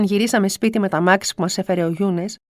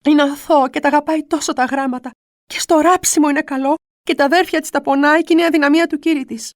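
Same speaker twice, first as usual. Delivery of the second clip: higher, pleading to the Virgin; pleading prayer; 5 semitones higher, 4 dB louder than usual. Retold or imitated. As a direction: beg for the child's life. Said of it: "έλεγα".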